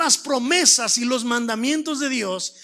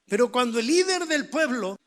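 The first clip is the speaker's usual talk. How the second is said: usual speaking voice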